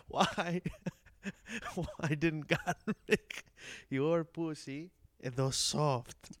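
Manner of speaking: put-on broken Greek-accented English, slow and cracking up; laughing through the quote. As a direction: try to repeat it but keep laughing. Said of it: "broken English".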